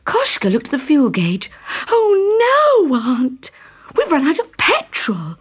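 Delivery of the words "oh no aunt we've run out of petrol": high cry sinking to a breathy whisper; panicked boy. Acted. Boy dismayed telling aunt the petrol ran out. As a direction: gasp it out in dismay.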